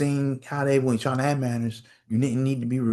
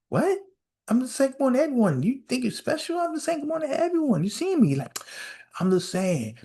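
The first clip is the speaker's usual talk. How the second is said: high, fast indignant comeback; shrill disbelief at being snubbed; indignant high retort; 3 semitones higher than usual. Retold or imitated. Guy on the unemployment line snaps back at the rude lady, high and fast.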